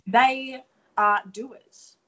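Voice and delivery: slow, loud, each word hit hard; emphatic. Real, not acted. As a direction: drive the point home word by word. Emphatic point about Angelenos.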